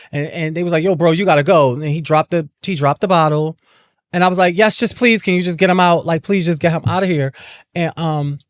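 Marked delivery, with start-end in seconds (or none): 0.8-1.7 loud retold command from a guard; a loud, firm voice telling the attacker to leave; firm order. Security guard orders him out. 4.6-7.3 desperate plea. Retold or imitated. Begging guards to remove attacker.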